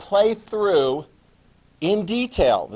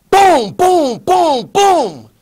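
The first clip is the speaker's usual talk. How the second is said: high, loud playful explosion noises; teacher booming artillery onto the map; loud booms; 11 semitones higher, 9 dB louder, much slower than usual. Retold or imitated. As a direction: bang out each boom like shells landing. Imitating artillery strikes excitedly.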